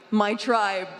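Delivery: usual speaking voice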